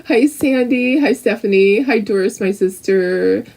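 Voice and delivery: normal talking voice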